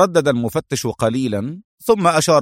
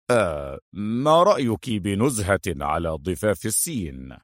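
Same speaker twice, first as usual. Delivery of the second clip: lower-pitched, casual suggestion; audible, casual cover; 3 semitones lower, 4 dB quieter than usual. A narrator's reading. Adham suggesting a stroll to cover his move, casually. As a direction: propose it casually for an eavesdropper. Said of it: "يقول بصوت مسموع".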